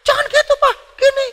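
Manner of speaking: put-on loud, very high child's wail; whining child. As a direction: play a kid pestering Dad at full pitch. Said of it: "anaknya masih ngomong". (suara anak merengek)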